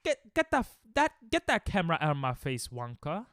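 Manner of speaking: hammed-up British accent, starting high and dropping; terrible British accent. British passer-by objecting to the camera, in a terrible British accent. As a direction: badly fake an indignant Brit. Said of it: "That's a terrible accent".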